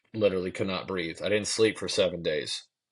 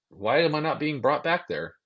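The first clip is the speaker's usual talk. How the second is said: higher-pitched, incredulous complaint; indignant disbelief; 8 semitones higher than usual. Retold or imitated. Patient left waiting in the emergency room, indignant. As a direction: retell a baffled protest, pitch lifted.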